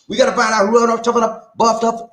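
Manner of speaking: quick, high, run-together delivery; fast. Real, not acted. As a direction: rattle it off excitedly. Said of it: "I'm supposed to say it slow".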